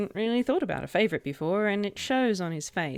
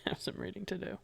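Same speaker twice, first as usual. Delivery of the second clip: whisper; 8 dB quieter, faster than usual. Acted. Chifley answers softly.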